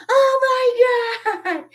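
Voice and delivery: very high, slow, loud retold wail; mock tearful shriek. Retelling a childhood fright by the janitor's trick, mock tearful shriek. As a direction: wail it like a horrified child about to cry. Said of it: "And I started crying".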